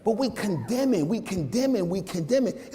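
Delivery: high, intense preaching cry. Acted. Pastor rebuking forgetful parents, fervent preaching. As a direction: preach it up with fervor.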